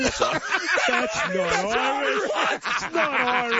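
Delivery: high, slow, drawn-out disbelief; incredulous. Real, not acted. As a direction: protest in amazement, stretching each word high. Incredulous at the supposed Irish clip.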